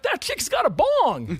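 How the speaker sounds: high, slow, stunned whisper of surprise; amazed high voice. Retold or imitated. Stunned reaction at concert.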